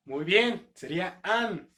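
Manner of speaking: usual speaking voice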